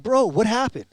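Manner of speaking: high, loud mock disbelief; retold urgent question. Retelling himself asking Cody after the race, high urgent question. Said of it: "I'm like".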